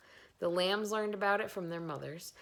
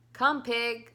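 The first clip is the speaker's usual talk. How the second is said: calling the pig; 6 semitones higher, 9 dB louder than usual. A narrator's reading.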